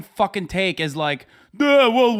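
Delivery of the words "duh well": loud, higher-pitched dopey voice; mock a stupid argument with a loud dumb voice; imitating a clueless arguer; mock dimwit. Mockingly quoting a dumb argument.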